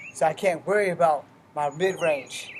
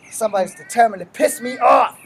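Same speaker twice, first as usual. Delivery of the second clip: fed-up shout; 5 semitones higher, 10 dB louder than usual. Real, not acted.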